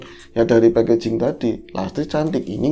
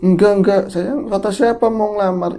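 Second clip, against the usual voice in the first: flustered denial; 8 semitones higher than usual. Retold or imitated. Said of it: "itu si Sarip".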